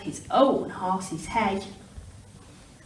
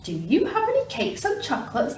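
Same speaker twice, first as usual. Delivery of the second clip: higher eager question; greedy cry; 7 semitones higher, faster than usual. Acted. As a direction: call out hungrily. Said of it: "the highway robber cried".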